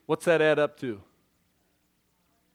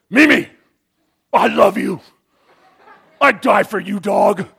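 much louder, barked at the dog; harsh loving words; 2 semitones higher, 11 dB louder than usual. Retold or imitated. Owner barking loving words at the poodle Mimi. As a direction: yell sweet words like a scolding. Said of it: "And then we go".

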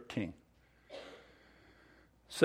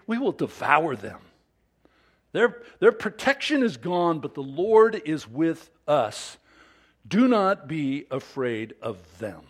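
loud, emphatic scripture reading of the spies' report; rousing the assembly, pressing each line home; read with emphasis; 2 semitones higher, 5 dB louder than usual. Acted. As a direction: urge the crowd on with every phrase. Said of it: "I read it with emphasis".

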